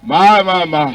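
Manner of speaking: a hint of a mother's sobbing cry; weeping mother. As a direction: voice the grieving mother. Mourning mother voiced in story.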